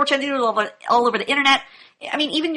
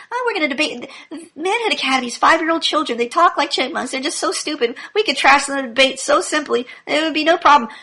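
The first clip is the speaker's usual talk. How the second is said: higher-pitched imitation of cocky challengers; mocking boast; 6 semitones higher than usual. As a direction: parody their big talk, pitched up. Mocking opponents' boasts about debating.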